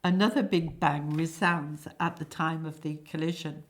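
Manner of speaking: ordinary talk, nothing marked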